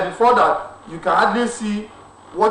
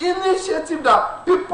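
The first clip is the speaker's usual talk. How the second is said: laughing, raised and eager; excited; 9 semitones higher than usual. Real, not acted. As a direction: cut in with a laughing shout. Excited overlapping crosstalk.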